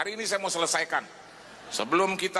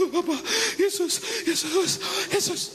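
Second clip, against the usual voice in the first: put-on crying child; 12 semitones higher than usual. Preacher voicing his youngest son crying on his shoulder. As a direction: whimper like a scared little boy. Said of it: "Nangis di pundak saya".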